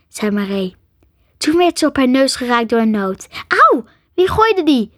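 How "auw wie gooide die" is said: high, loud cry of ouch; startled, raised voice; indignant yelp. A narrator's reading. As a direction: demand to know who threw it. Hit on the nose by nut.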